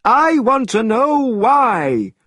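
high, loud, slow scolding; angrily. A narrator's reading. The immortal angrily scolding the students, loud and slow. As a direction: scold them slowly and hard. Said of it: "said the immortal angrily".

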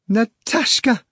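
high scream of a name. A narrator's reading. Margarita screams to Natasha, high scream. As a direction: scream it out across the sky. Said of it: "Margarita screamed".

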